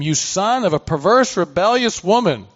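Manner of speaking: reading as an enraged king; Saul's anger. Acted. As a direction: hurl the insult at your son as furious Saul. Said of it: "Then Saul's anger burned".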